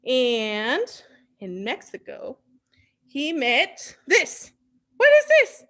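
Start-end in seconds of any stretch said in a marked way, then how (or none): 5.0-5.6 playful surprise. Pretend. Teacher shows strange animal.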